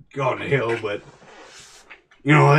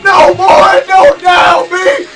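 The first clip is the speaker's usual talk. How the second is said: high, very loud battlefield flashback screams; anguished screaming; 11 dB louder, slower than usual. Acted. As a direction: scream it in full panic and grief. Flashback to friend dying in war.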